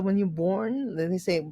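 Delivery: usual speaking voice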